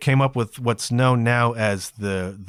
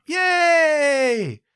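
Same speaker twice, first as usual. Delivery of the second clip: mock kids' cheer; kids cheering; 14 semitones higher than usual. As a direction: shout it like a crowd of thrilled kids. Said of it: "And they all lit up".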